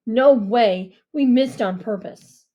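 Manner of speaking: put-on loud indignant boy; loud protest. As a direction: shout back in defence. Deke protesting loudly.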